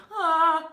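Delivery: loud, high panicked scream, imitated; mock scream. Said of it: "and they scream".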